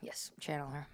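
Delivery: soft hushed whisper. Real, not acted. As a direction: whisper it low. Whispered reply.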